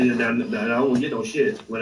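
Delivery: normal talking voice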